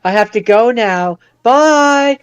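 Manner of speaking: high, put-on goodbye rising into a long call; hammed-up exit, voice climbing; mock hasty farewell. Co-host mimicking the hero's sudden exit, hammed-up farewell.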